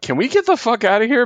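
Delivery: high-pitched urge to flee; mock alarm. Teammates wanting out of Sinister's lab, mock alarmed.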